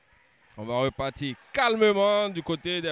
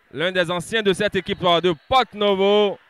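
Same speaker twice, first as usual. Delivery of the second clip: normal talking voice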